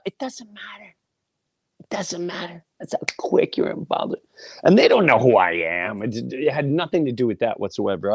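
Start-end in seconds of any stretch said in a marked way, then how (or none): 0.0-4.2 unvoiced intense whisper. Real, not acted. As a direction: hush it for weight. Whispered emphasis.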